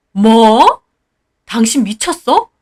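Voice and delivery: sudden loud outburst; shocked husband. Acted. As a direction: explode in disbelief. (놀란 남편의 버럭)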